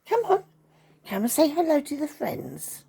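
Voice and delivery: very high, loud dog-talk; coaxing the dog. Real, not acted. Coaxing the dog to come over.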